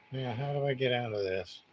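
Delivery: normal talking voice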